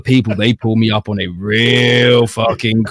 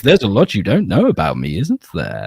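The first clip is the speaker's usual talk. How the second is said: knowing comeback; 4 semitones lower, 3 dB quieter than usual. Retold or imitated. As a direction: voice dropped low for a sly retort. Acted comeback to the puzzled friends.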